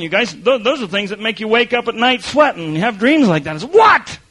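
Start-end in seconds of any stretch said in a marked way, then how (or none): 3.6-4.2 startled. Retold or imitated. Waking from a nightmare.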